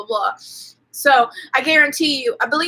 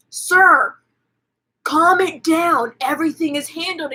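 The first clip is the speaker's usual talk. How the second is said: cop voice; 4 semitones higher than usual. Retold or imitated. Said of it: "they're like".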